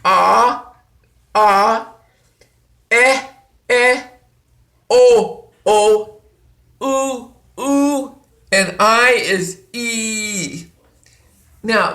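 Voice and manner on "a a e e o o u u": each vowel called out louder, one by one; vowel drill. Shown as a demonstration. Teacher calling out Spanish vowels loudly for students. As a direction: call the vowels for the class to repeat. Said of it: "here are the vowels".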